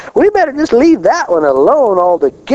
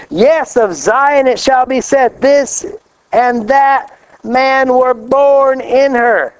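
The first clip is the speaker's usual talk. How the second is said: scripture reading; 7 semitones higher, slower than usual. Acted. Reads scripture slowly, raised.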